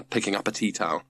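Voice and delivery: plain reading voice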